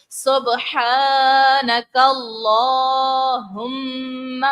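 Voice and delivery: سبحانک اللہم sung slowly, the لام held one alif long; lengthened lam. Shown as a demonstration. Teacher demonstrating the stretched لام to students, in slow chant. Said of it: "ایک الف کی مقدار ہم اس کو کھینچیں گے".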